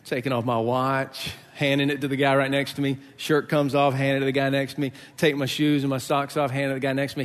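usual speaking voice

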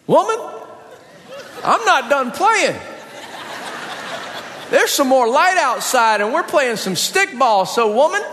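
put-on high, cheeky little-boy voice; sassy kid. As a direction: talk back to mama like a brat. Kid talking back to mom.

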